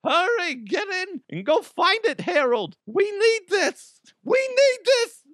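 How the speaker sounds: put-on very high, screechy old-lady voice; hammed-up panicky old wife; frantic old woman. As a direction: urge on an old husband, high-pitched. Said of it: "a bunch of old guys rushing".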